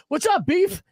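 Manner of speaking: high, eager fan calling out a nickname; excited fan greeting. Retold or imitated. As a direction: greet him like a starstruck listener. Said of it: "and he goes".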